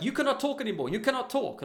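high, loud mock scolding; hammed-up shrieky ban; mimicked angry driver. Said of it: "early on, he was like".

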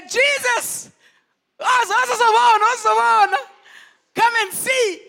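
high, loud astonished cry; shocked Peter. Retold or imitated. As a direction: call out in amazement. Peter shocked at withered tree.

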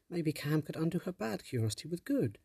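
an idea lighting up; young girl voice. Acted. Tess proposes a plan.

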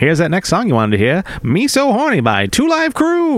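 chipper assistant voice. Pretend. Playing the earbud assistant.

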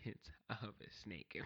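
a hushed hammed-up tough guy flexing tattoo; mock tough guy. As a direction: brag it low like a tiny biker.

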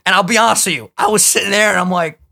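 ordinary talk, nothing marked

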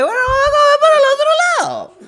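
very high, fast falsetto with a laugh; mock-relaxed falsetto after the tooth cracks; falsetto calm. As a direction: squeak it out, pretending all is fine. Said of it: "el tono de voz se te va al agudo".

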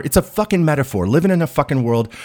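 ordinary talk, nothing marked

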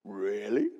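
low, doubting rising really; sceptical really. Retold or imitated. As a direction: sound unconvinced. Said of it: "standing there and going".

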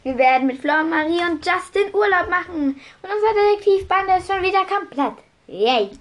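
bright read-aloud narration; story reading. A narrator's reading. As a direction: read it to the class. Reads a passage aloud.

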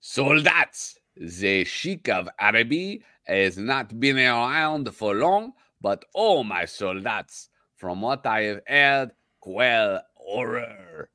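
loud, slow hammed-up French sergeant; French accent. Cohost reading the sergeant's line, slow hammy French accent. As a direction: ham up a French officer, drawn out. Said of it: "You wanna read that?".

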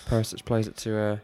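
lively play-by-play commentator voice; mock commentary. Friend plays commentator over the match, live commentary. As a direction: call the match like a TV pundit.

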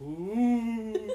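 high, spooky ghost ooh; imitating a ghost's moan. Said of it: "It sounds like you're going".

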